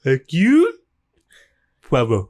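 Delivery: mock curse with a lifted 'you'; hammed-up Poirot sign-off. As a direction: pitch up on 'you', pause, drop on the name. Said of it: "One more time".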